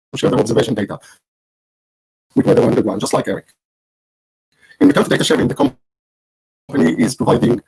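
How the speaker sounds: glitchy robotic call audio; choppy, garbled digital connection. Real, not acted. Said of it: "you sound like a robot right now".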